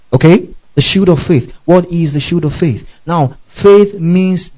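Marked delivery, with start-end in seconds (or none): none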